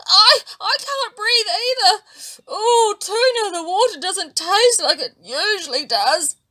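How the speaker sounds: high, loud, tearful fear; frightened crying. A narrator's reading. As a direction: whimper out the fear. Fish cannot breathe, calls Tuna.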